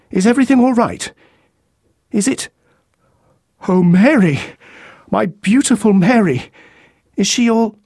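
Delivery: fast, high, rising panic over his wife; terrified man calling his wife's name; panicked husband. A narrator's reading. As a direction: voice climbing as dread sets in. Husband fears for his wife Mary.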